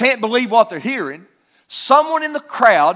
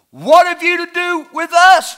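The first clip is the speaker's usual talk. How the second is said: sharply higher, louder demon's outcry; demon crying out loud; 8 semitones higher, 5 dB louder than usual. Retold or imitated. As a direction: cry it out as the possessed man. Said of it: "He cries out with a loud voice".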